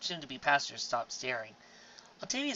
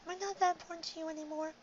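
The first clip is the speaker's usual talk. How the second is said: hurt pleading; 4 dB quieter, faster than usual. Acted. Hurt question after outburst.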